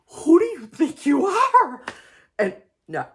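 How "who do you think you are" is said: much higher and louder, laughing through a pretend huff; hammed-up indignant yell, cracking into a laugh; mock outrage. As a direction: fake fury at the husband, giggling. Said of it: "I didn't say that to him".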